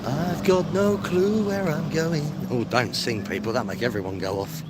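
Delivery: ordinary talk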